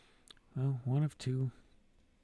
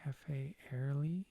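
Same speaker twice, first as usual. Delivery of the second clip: slow whispered reading; slower than usual. Real, not acted. Player reading a cafe sign, slow whisper. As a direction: sound it out in a whisper, slowly.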